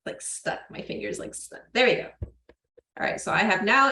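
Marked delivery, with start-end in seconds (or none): 0.0-1.6 breathless strained whisper while pushing; effortful whispered aside. Real, not acted. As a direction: whisper it under effort, finger stuck. Straining to push ball in.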